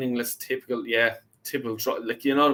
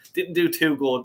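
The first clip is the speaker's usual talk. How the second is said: usual speaking voice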